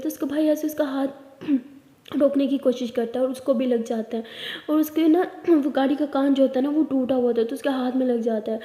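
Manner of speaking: ordinary delivery, nothing marked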